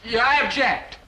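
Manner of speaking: high, sudden cry of objection; courtroom objection yell. Acted. Son interrupting the prosecutor at court martial, yelling. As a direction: burst in with a high-pitched objection. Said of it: "you jump up and yell".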